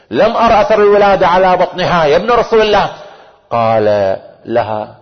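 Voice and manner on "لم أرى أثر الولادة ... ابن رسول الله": high-pitched, dramatised appeal; amazed protest. Retold or imitated. Aunt telling the Imam she saw no pregnancy, amazed protest. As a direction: voice the aunt's protest in a raised preacher's pitch.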